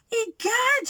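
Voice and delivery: gasp, then a high exclamation; startled cry. A narrator's reading. Goldie sees what Baby Bear has made, startled cry. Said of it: "she cried".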